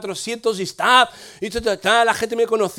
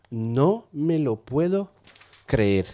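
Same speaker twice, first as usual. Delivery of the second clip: mascullar lento y grave, incrédulo; incredulidad imitada; 6 semitones lower, 3 dB quieter, much slower than usual. Retold or imitated. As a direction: imitar a quien no se lo puede creer, despacio. Imita reacción incrédula del joven. (incredulidad imitada, lenta y grave)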